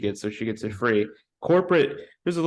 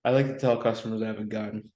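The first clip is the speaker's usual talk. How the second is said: ordinary talk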